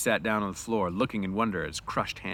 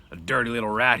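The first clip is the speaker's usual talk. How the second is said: louder, disgusted outburst; angry cry; 3 semitones higher, 7 dB louder than usual. Acted. Slim jumps up at the bully, angry cry. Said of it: "he cried".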